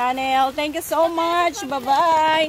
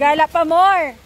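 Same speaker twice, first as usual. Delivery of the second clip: teasing; 7 dB louder than usual. Real, not acted. Teasing mom.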